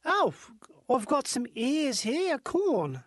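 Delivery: slow, put-on New Zealand accent. Shown as a demonstration. Showing how New Zealanders say ears of corn, New Zealand accent. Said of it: "with a New Zealand accent".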